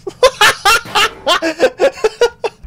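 high, loud burst of laughter; gleeful cackle. Real, not acted. Player after speeding, high gleeful laugh.

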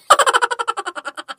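sudden high falling laugh; a squeaky burst of laughter; high-pitched giggle that falls away. Acted. Man laughing at the delay.

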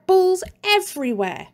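usual speaking voice

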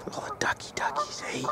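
soft high whisper to the ducks. Real, not acted. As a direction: hush so the ducks won't hear.

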